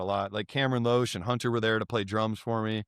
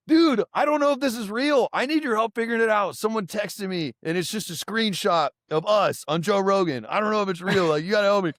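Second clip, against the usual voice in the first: high-pitched, urgent plea for help; imitating an urgent caller; 8 semitones higher than usual. Rapper phones his friend about a screenshot, high urgent imitation. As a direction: put on a higher, pleading voice. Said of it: "and he was like".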